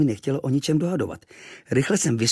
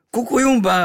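much higher and louder yell; sudden shriek; 13 semitones higher, 7 dB louder than usual. Acted. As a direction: shriek it out of nowhere. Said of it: "zaječel".

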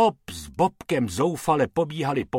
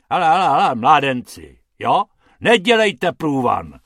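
louder gruff growl at the rabbits; 3 semitones higher, 8 dB louder than usual. Acted. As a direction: snarl at the boys to clear off. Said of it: "zavrčel pan Hrubec".

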